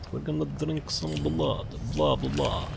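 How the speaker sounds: playful, lightly put-on vampire voice; mock vampire. Gamer playfully announces drinking blood, mock vampire. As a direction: ham up a little vampire bit.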